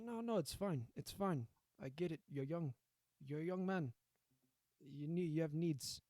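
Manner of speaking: attempted Italian mob-boss accent; rough go at an Italian accent; Italian mobster accent. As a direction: reassure like an Italian-accented Don. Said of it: "I can't do an Italian accent".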